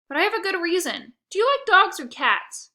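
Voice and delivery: eager. A narrator's reading. Boy changes subject eagerly.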